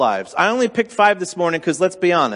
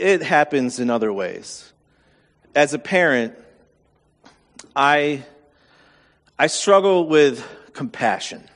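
ordinary talk

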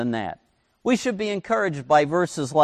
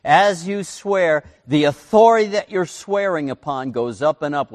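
ordinary talk, nothing marked

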